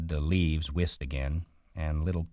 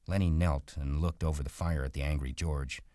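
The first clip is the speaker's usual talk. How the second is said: plain reading voice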